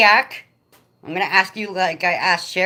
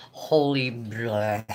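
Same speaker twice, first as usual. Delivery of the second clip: exasperated; 7 semitones lower, 5 dB quieter, much slower than usual. Real, not acted. Exasperated about kids off school.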